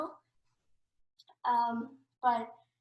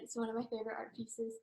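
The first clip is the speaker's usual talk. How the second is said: normal talking voice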